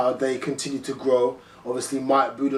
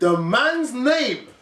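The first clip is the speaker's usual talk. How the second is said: very slow, higher, emphatic announcement; drawn-out public correction; mock proclamation; 7 semitones higher, much slower than usual. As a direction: spell it out for everyone, word by word.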